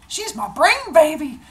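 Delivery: high, loud, gleeful squeal; doting boast. Acted. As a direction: show off your baby in a hammed-up high voice. Zeus boasts of his child.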